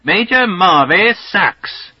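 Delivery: slow, high, hammed-up English accent call; English accent holler. Slothrop hollering provocation down the long tunnel, English accent holler. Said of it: "hollers in his most English accent".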